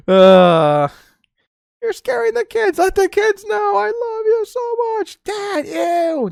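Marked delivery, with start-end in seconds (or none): 1.8-6.3 very high squeaky put-on voices; neighbours' quarrel retold in a squeaky voice; mock couple argument. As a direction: ham up the domestic spat. Acting out couple's yelling match.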